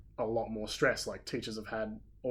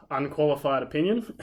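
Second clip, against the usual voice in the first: teasing, higher, louder repeat; incredulous echo; 4 semitones higher, 7 dB louder than usual. Retold or imitated. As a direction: throw the phrase back amused. Echoing friend's phrase with sigh.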